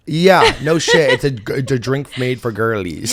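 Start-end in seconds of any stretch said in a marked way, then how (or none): 0.1-1.1 slow, loud, louder emphatic yeah; drawn-out emphatic agreement. Real, not acted. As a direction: drag it out, sure of it.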